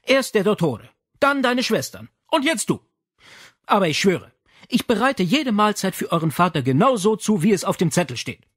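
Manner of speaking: indignant mother. Acted.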